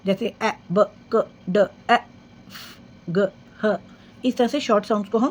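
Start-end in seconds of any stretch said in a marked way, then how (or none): 0.1-3.8 letters said as short phonics sounds; ए, ब, क, ड said as bare short sounds. Shown as a demonstration. Teacher showing children the short phonics sounds. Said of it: "short sounds को हम फोनिक्स के साथ बोलते हैं".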